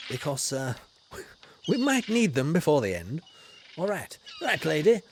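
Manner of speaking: ordinary delivery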